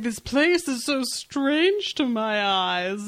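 slow, drawn-out mock whine; hammed-up whiny complaint. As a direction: whine it out plaintively. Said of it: "It's so whiny".

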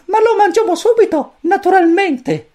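very high, squeaky little chick voice; cosmic chick voice. A narrator's reading. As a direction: chirp it like a tiny alien chick. Pulcino cosmico. (vocina acutissima da pulcino)

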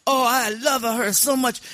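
put-on gushing, high-pitched Sicilian accent; Sicilian mob boss. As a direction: dote like a hammed-up Sicilian don. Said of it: "Sicilian mob boss once".

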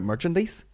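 "merchandise" ending in a hiss like "geese"; odd pronunciation. Shown as a demonstration. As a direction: say "merchandise" with its last syllable as "deece". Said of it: "that's how it's pronounced".

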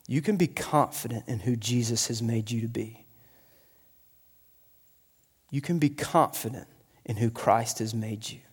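low, very soft sincerity; gentle reassurance. Real, not acted. Preacher telling listeners what the gospel says, gentle reassurance.

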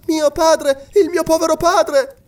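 very high, loud, desperate cry; anguished exclamation. A narrator's reading. Daughter falling at the lord's knees, crying out in anguish. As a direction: cry out in grief for a lost father. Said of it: "esclamò Mary cadendo alle ginocchia".

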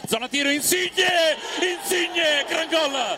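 high, slowed shouted goal call. Real, not acted. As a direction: roar the name, then the goal. Insigne scores third goal.

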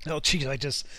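hoarse, still choking after the cough; choked-up voice. Real, not acted. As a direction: talk through the choke, throat still catching. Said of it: "something just went down the wrong pipe".

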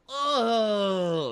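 long, high posing "oh"; hammed-up moan, held and slowly falling; mock seductive moan. [name] posing while he ignores her, mock seductive moan.

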